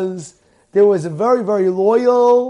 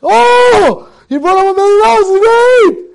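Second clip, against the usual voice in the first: high, loud, quick burst of delight; acted wife thrilled by the money; overjoyed exclamation; 9 semitones higher, 8 dB louder than usual. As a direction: shriek at finding a fortune. Wife opens suitcase of money.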